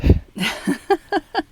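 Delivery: a real laugh that breaks out high and loud; amused laugh. Real, not acted. Author laughs after telling readers to skip the characters, amused laugh. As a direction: laugh at your own shrug-off. Laughs off his own joke.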